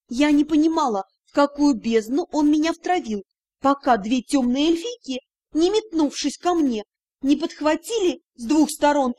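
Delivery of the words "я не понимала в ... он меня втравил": usual speaking voice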